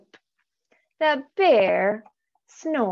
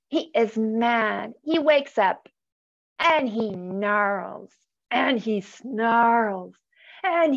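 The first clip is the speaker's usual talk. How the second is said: put-on animated storybook reading for kids; reading to children. A narrator's reading. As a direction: read it aloud like a bedtime picture book. Reads picture book to children.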